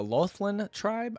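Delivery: slow, rising, tentative reading; unsure pronunciation. Real, not acted. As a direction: sound out an unfamiliar name as if asking. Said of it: "I wanna say that's how you say it".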